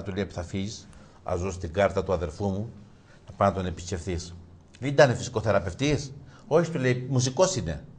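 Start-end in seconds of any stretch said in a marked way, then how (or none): none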